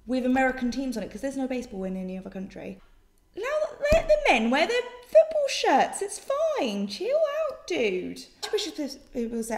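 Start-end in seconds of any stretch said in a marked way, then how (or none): 3.4-8.2 mock outrage. Mock outraged defence of football shirts.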